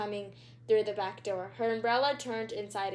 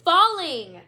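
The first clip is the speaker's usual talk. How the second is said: higher and louder, panicked yelling; yelled; 8 semitones higher, 8 dB louder than usual. A narrator's reading. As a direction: shout it like the sky is really falling.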